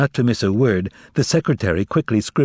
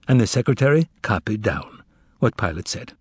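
plain reading voice

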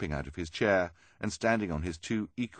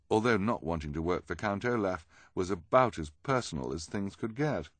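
plain reading voice

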